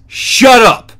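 loud, high-pitched fed-up yell; exasperated command. Real, not acted. Host to cargo-shorts protesters, exasperated shout.

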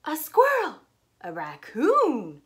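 loud startled cry, high then slow; shocked shriek. Acted. City folks spotting the animals, loud shocked cry. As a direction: scream in fright.

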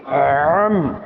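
আম said as عم with the throat squeezed, deep ain; আম with a throaty Arabic ain at the start; pressed throat sound. Shown as a demonstration. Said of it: "কণ্ঠনালীটাকে চেপে যদি আমরা পড়ি".

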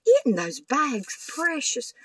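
high, slow, delighted coo; Isn't that precious drawn out high and slow; gushing delight. Real, not acted. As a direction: swoon over how precious it is. Gushing over bags.

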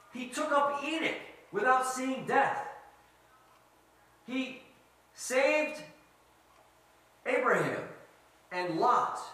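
high, loud, emphatic sermon build; impassioned preaching. Real, not acted. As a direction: drive each rescue home to the congregation. Preaches deliverance at full force.

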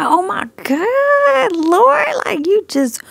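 high, drawn-out amazement; high astonished exclamation, settling down. Retold or imitated. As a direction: retell stunned awe to God, pitch way up. Retells amazement at rewatched video.

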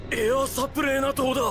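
high, quick, excited voice; astonished realisation. Acted. Fighter realising the holy corpse let them escape, astonished. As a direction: realise it with rising amazement.

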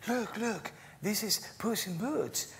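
high-pitched mother showing off a star; excited mother. Retold or imitated. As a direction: pitch it way up, eager to impress a child. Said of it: "she said to him".

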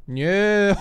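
ordinary talk

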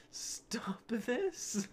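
high, airy read breaking into laughter; high whispery voice, cracking up; laughing repeat. Acted. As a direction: say it again through giggles.

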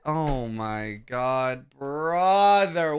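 usual speaking voice

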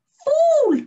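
high, loud scornful shout; mocking jeer. A narrator's reading. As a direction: jeer at her in mockery. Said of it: "they all laughed and said".

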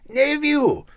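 high, loud stern retort. A narrator's reading. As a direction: bark it sternly at the nephew. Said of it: "returned the uncle sternly".

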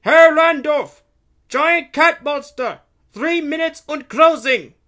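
very high, loud, strained lookout's yell; gatekeeper calling out. A narrator's reading. Gatekeeper warning Randolph of the cat monster, yelling. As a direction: shout the alarm across the courtyard. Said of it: "the gatekeeper calls out to him".

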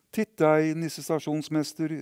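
high, loud playful call; boy calling out. Acted. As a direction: call the stationmaster out loudly. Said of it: "ropte gutten".

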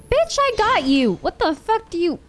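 high-pitched, jumping indignant protest; indignant outburst. Real, not acted. Player to the game after a missed catch, indignant.

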